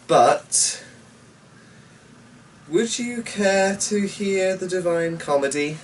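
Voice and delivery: ordinary delivery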